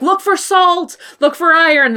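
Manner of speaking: urgent commands. Retold or imitated. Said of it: "Sam is like".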